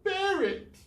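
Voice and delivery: slow high wail. Acted. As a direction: plead through the sobs. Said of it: "she wailed even louder".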